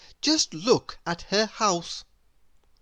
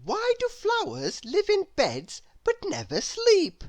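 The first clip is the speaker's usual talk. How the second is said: put-on a curious little girl asking sweetly; little Miss Curious voice; 14 semitones higher than usual. Narrator voices Miss Curious asking her door. Character voice.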